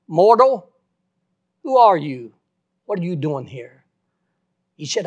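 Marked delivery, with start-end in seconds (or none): 0.1-3.9 booming call of Mortal, loud and then fading; God's voice thundering down at the dead preacher, loud and awesome. Retold or imitated. The preacher retells the voice in heaven demanding who he is, booming. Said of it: "the most awesome voice I ever heard".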